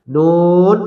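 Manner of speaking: নুন with a long, held vowel; drawn-out letter name. Shown as a demonstration. Teacher naming a letter for learners, drawn out. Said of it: "টেনে বলতে হবে".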